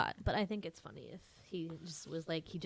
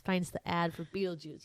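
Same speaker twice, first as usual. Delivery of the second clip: ordinary delivery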